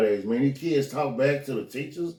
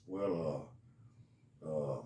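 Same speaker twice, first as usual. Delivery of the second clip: much lower, much softer, hesitant confession; stammering kid; 9 semitones lower, 7 dB quieter than usual. Retold or imitated. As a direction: stall before admitting it.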